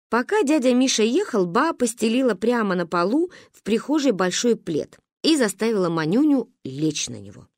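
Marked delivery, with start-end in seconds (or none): none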